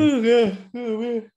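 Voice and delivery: talking through a big yawn; words stretched out by a yawn. Real, not acted. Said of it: "You're yawning more than me today".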